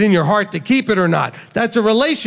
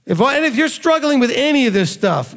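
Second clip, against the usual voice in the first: ordinary delivery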